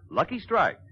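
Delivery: ordinary talk, nothing marked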